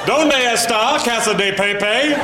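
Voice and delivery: overdone tourist Spanish; sounded-out Spanish. Shown as a demonstration. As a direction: sing out "Donde está" syllable by syllable. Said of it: "every vowel is a syllable".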